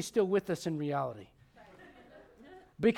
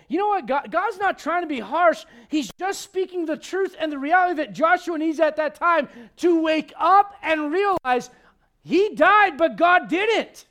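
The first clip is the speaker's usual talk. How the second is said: much higher and louder, slowing at the end; preaching at full force; 8 semitones higher, 10 dB louder than usual. Real, not acted. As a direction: shout the truth to the congregation. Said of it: "preacher at full force".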